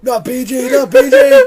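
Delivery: high, loud protesting yell; shouting. Acted. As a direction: yell it in mock outrage.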